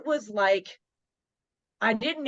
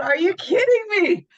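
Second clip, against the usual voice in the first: much higher and louder, stunned; shocked disbelief; 11 semitones higher, 8 dB louder than usual. Retold or imitated. Hearing about six hundred kids, shocked disbelief. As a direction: blurt it out in shock. Shocked by the school-wide assembly request.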